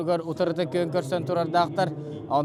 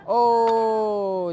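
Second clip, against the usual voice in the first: long, high drawn-out "oh"; excited exclamation; 6 semitones higher, 4 dB louder than usual. Real, not acted. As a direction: let out a stretched, high cry of amazement. Commentator reacts to a scoring move.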